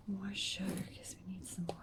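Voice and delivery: unvoiced whisper aside. Real, not acted. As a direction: breathe it under your breath. Whispered aside while crafting.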